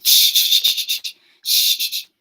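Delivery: long hissed shushes; loud shushing. Retold or imitated. Imitating a hushed pub quiz crowd, loud drawn-out shushing. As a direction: imitate people shushing each other. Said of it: "you can hear loads of people going".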